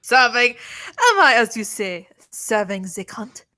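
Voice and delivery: loud mock accent, slipping from German to French; botched German accent with a French slant; attempted German accent turning French. Co-player echoing the prosecutor's line, in a botched French-sounding accent. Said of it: "I do a French accent".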